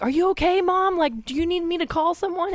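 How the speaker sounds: mock screaming worry down the phone; imitating a worried scream. Sister on the phone to mom, mock screaming. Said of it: "on the cell phone screaming".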